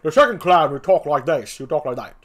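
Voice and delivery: forceful put-on clown voice; second clown voice. Shown as a demonstration. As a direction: try out a rougher second gravedigger. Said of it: "And the second clown will talk like".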